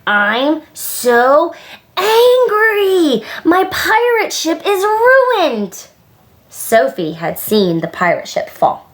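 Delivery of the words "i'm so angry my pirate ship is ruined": put-on high, drawn-out child's tantrum; hammed-up furious yell, voice shooting up; angry yell. A narrator's reading. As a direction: wail it out in storybook fury. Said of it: "and yelled".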